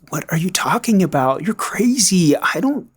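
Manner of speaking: mock incredulous, pitch leaping up; gaslighting denial. Addict husband denying feelings for another to his wife, incredulous. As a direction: act out an innocent, baffled protest. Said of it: "it was gaslighting".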